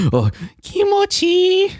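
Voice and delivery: put-on very high, breathy anime girl; squeaky anime girl. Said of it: "the anime girl".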